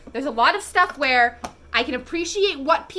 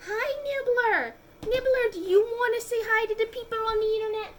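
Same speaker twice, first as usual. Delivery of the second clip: pet talk; 11 semitones higher than usual. Real, not acted. Talking sweetly to her cat.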